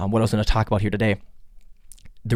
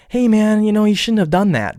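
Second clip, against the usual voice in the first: higher, easygoing, meant casually; light friendly text; 10 semitones higher, 3 dB louder than usual. Retold or imitated. Texting a friend about a mistake, light and friendly. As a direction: toss it off lightly, no offense meant. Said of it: "You may have texted it".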